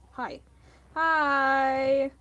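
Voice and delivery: bright loud hi to a viewer; excited greeting. Real, not acted. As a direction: greet them like a big surprise.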